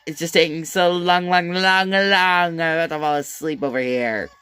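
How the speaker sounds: high, loud, stretched-out long long long; mock boredom, stretch every long; mocking drawl. Mocking the class for the slow tearjerker, bored drawl. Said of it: "I'm sorry to interrupt".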